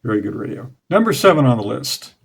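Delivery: usual speaking voice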